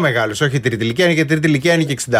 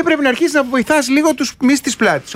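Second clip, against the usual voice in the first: higher mock trainer voice; imitating the trainer; 9 semitones higher than usual. Retelling what the gym trainer said, lightly mocked higher voice. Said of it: "μου λέει".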